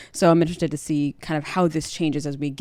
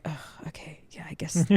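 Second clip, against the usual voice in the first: hushed, sighing mock resignation; reluctant giving in; 9 dB quieter than usual. Ned gives in about the tourney, imitated weary resignation. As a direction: breathe it out softly like a worn-down dad.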